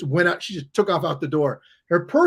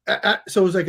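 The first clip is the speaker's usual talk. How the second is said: ordinary talk, nothing marked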